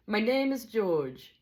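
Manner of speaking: slow, high Scottish character voice; lightly voiced Scottish teacher. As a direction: introduce yourself slowly as a storybook Scotsman.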